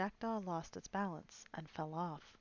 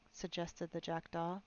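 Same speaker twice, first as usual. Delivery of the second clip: plain reading voice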